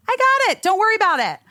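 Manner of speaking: very high, eager reassurance. Retold or imitated. Passenger telling the mother she'll get the bag, high and eager. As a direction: pitch it way up to take over the task. Retells jumping up to help.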